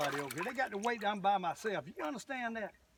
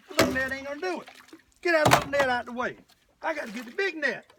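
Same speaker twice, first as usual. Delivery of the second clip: higher, hurried and worked up; real excited urgency; 5 semitones higher, 4 dB louder, faster than usual. Real, not acted. Angler scrambling for the big net, excited and urgent. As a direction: call out urgently mid-fight.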